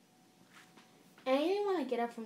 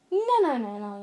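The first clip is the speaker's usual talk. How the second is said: raised-voice brotherly protest; protective objection; 8 dB louder than usual. A narrator's reading. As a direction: cut in and forbid it, pitch jumping up. Brother objects to them going upstairs.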